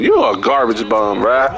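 ordinary talk

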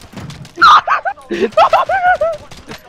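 shrieking laugh. Real, not acted.

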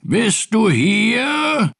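very drawn-out, high searching call; long-held questioning shout. A narrator's reading. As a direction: holler it slowly across the yard. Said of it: "rief Pettersson".

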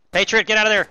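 urgent call to a teammate; shout. Real, not acted. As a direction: yell a warning. Calling out to teammate.

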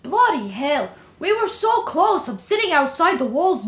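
careful attempt at British, leaning Irish; British-Irish accent. Shown as a demonstration. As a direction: read it in British drifting towards Irish. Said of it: "it's a little bit Irish".

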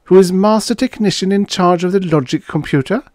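high, loud probing question; persistent. A narrator's reading. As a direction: keep pressing, pitched well up. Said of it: "she persisted".

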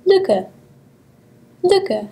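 Lücke with a short rounded ü; German pronunciation. Shown as a demonstration. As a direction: say Lücke, lips rounded, tongue front. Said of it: "example word to practice with".

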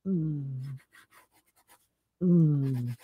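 low, soft closed-mouth "mm"; breath-holding moan. Shown as a demonstration. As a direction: demonstrate a quiet moan on held breath. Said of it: "this is what I would do".

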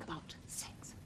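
hushed slow whisper. Acted. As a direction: whisper it so nobody overhears. Whispered shy question about sex.

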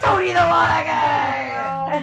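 high, drawn-out bellowed catchphrase; mock-triumphant yell; hammed-up shout. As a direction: belt it out slowly like a mock announcement.

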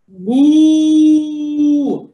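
long, loud held moo; cow moo. Shown as a demonstration. Answering for the cow for Janie, loud mooing. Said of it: "what does the cow say?".

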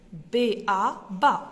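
B-A sounded out, then ba blended; teacher sounding out B-A into ba; letters then syllable. Shown as a demonstration. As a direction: spell B-A, then land on ba. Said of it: "on va dire B-A".

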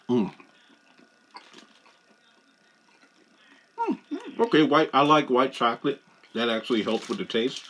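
speaking between bites; chewing while talking. Real, not acted. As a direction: mouth partly full of food. Seen 0: bit treat at -5, still holds it, mouth working while speaking.

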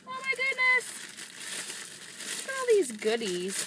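high, overjoyed exclamation; high squealing amazement; thrilled. Real, not acted. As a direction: gasp it out in delight. Overwhelmed by the gifts.

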